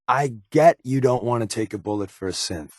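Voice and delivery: ordinary talk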